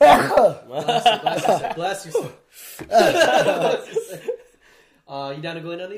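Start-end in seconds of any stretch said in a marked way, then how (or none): 0.0-4.3 much louder, higher, laughing; mock blessing. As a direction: ham up a blessing while laughing.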